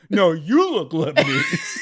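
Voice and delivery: high, slow, laughing mock shout; hammed-up insistent principal. Principal doubling down on the cop, hammed-up shout. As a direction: insist it, half yelling and laughing. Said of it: "That's how they find their way out of it".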